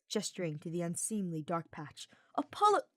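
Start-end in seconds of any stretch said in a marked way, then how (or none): 2.4-2.9 indignant. A narrator's reading. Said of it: "face turned indignant".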